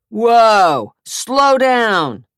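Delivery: high, loud shout to stop; loud warning. A narrator's reading. Cop to Zach swinging his crutch, loud warning. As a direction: hold him back with your voice. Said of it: "one of the cops advised".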